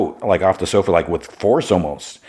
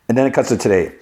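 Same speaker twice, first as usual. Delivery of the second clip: usual speaking voice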